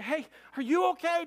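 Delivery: high, loud anxious call; urgent concern. Retold or imitated. Driver checks the girl in back, high and urgent.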